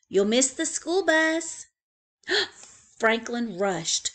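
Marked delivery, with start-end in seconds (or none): 0.1-1.6 higher, louder hurry-up call; mother calling. Acted. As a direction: hurry him along like a mom. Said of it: "said his mother".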